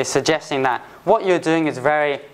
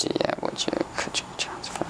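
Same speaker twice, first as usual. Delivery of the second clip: whispered aside; 10 dB quieter than usual. Real, not acted. Teacher muttering alternatives.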